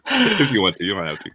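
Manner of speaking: laughing. Real, not acted. Laughing while inviting a try.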